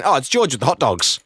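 ordinary talk, nothing marked

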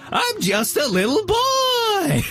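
put-on very high, slow, whiny little boy; high whimpering little boy; Mulaney squeal. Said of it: "who's like".

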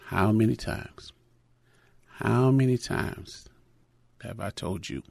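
hushed and slow, fading almost to nothing; quiet inner voice. Retold or imitated. God's voice echoes the father's scolding, hushed and slow. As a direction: let it come back softly like God speaking. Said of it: "I heard his voice say".

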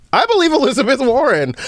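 usual speaking voice

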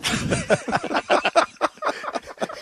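wheezing high laugh; high breathy laugh. Real, not acted. Laughs at garage story.

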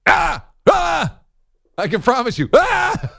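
high, loud caveman shouts; caveman grunts. Retold or imitated. Host voices Boyle as a caveman, shouting.

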